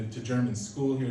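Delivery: ordinary talk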